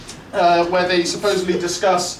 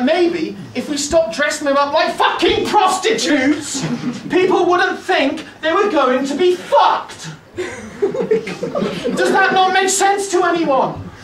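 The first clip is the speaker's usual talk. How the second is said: exasperated rant; 8 semitones higher, slower than usual. Real, not acted. Ranting at the audience.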